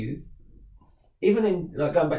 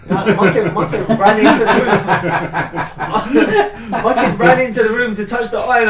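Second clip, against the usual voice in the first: laughing hard, higher and louder; 6 semitones higher, 11 dB louder than usual. Real, not acted. Laughing while telling kid story.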